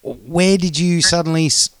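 usual speaking voice

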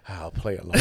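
low, soft and resigned; small, low murmur of a voice; meek imitation. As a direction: imitate someone going along quietly. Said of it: "Danny's like".

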